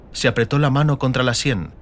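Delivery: ordinary talk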